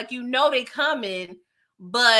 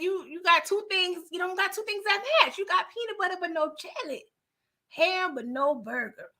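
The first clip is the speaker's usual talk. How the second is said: lightly voiced Smokey, higher and teasing; a light Smokey voice teasing Craig; voicing Smokey; 7 semitones higher, 4 dB quieter than usual. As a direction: roast his boy Craig with mock wisdom. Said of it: "Smokey already was telling".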